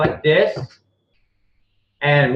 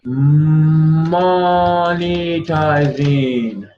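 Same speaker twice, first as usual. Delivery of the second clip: spelling it out slowly; much slower than usual. Real, not acted. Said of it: "oh, right here".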